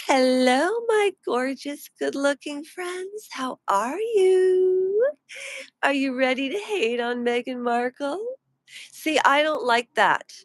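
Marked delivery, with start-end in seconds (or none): 0.1-8.3 imitating Meghan's mole. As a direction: gush like a fake-friendly YouTube host. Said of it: "Meghan's mole would come on and she would say".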